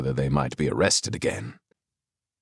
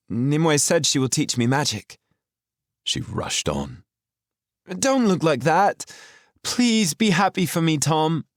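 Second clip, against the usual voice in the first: lightly voiced eager girl pleading; an earnest girl begging him to be happy; a hint of a pleading young girl, high; 12 semitones higher, 4 dB louder than usual.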